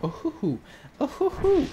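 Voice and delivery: ordinary delivery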